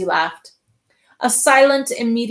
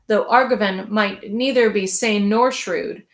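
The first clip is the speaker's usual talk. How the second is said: ordinary delivery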